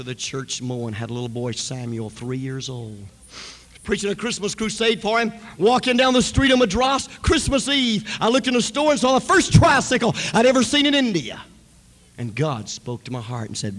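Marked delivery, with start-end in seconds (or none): 3.8-11.4 high, loud preaching; preaching at full force. Real, not acted. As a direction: preach it out to the crowd.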